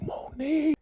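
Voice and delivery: high desperate mock yell of her name. Frank yells for Monique after the car blast, high mock cry. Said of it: "And he's yelling a name".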